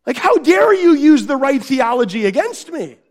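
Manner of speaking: loud, high mock-indignant outburst; hammed-up parental indignation; really angry. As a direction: play the outraged dad for laughs. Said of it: "then I was really angry".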